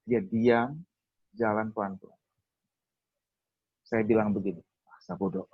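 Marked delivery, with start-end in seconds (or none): 4.8-5.5 hushed, voiceless aside; soft, whispered shrug of words; retold resignation. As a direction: imitate dismissing it under the breath. Retold giving up on fear.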